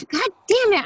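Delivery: high, frustrated curse at a flubbed line; exasperated outburst. Real, not acted. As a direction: burst out laughing in frustration. Botches the line again.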